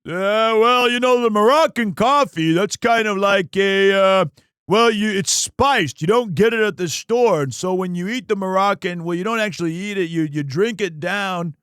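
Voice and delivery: loud, rambling football-commentator impression; booming mock sportscaster, easing off towards the end; John Madden impression. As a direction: bluster loudly through a rambling explanation. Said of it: "All you have to do is a Scottish John Madden".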